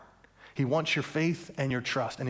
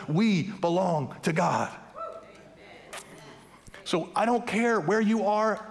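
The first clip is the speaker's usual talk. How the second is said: fiery preaching; 6 semitones higher than usual. Acted. Pastor urging the church to believe, preaching hard. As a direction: drive each line home to the congregation. Preaching rises to full force.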